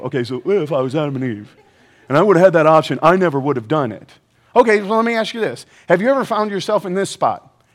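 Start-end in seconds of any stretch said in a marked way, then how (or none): 0.0-4.1 low, put-on know-it-all objector; mock objector voice. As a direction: mimic a congregant protesting in a low voice. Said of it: "I don't know why you talk like that".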